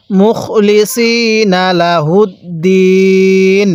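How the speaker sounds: very slow, stretch the last vowel of লাহুদ্দিন and close on N; recitation with stop. Shown as a demonstration. Teacher joins the phrase for students, drawn-out recitation to a stop. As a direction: chant মুখলিসিনা লাহুদ্দিন slowly, দিন held long and level. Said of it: "এখানে আমরা ওয়াকফ করব".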